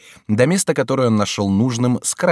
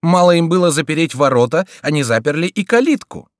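high, loud frightened complaint; indignant alarm; 7 semitones higher, 6 dB louder than usual. A narrator's reading. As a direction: protest in fear at the locked gate. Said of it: "вскричал Фриар бледнее".

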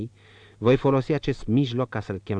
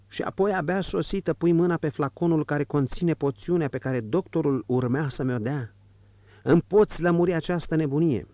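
a higher, agitated voice for the indignant father; the father demanding an explanation, higher and tense; angry father; 8 semitones higher than usual. Acted. Old count scolding his daughter, high agitated reproach.

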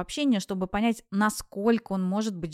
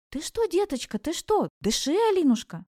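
high, anxious coaxing; worried and pleading, pitched well up; alarmed grandmother; 7 semitones higher than usual. A narrator's reading. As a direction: urge her anxiously back to breathing. Grandmother urges heroine to breathe.

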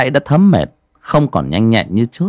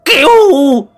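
high, loud desperate screaming, slow; shouting for help; 6 dB louder, slower than usual. Acted. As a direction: scream for help while running. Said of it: "vừa chạy vừa la hét".

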